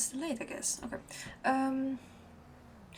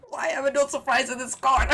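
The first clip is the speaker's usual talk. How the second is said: loud, fast, laughing disbelief; 4 semitones higher, 14 dB louder, faster than usual. Real, not acted. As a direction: laugh it out, amused. Laughs at the car.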